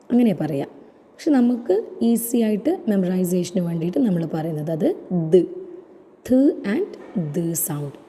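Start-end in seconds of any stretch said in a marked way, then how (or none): none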